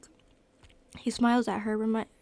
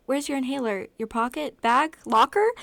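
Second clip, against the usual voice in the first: loud, higher questions climbing in urgency; frantic questioning; 3 semitones higher, 9 dB louder than usual. A narrator's reading. Brother hunts for his sister's inhaler, frantic. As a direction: fire off panicked questions, building louder. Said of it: "speaking frantically".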